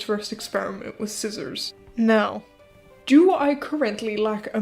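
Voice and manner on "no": ordinary delivery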